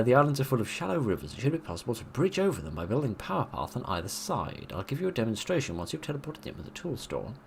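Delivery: lightly heightened mission-briefing read; briefing read aloud. Acted. Streamer reads level briefing to viewers, mild announcer read.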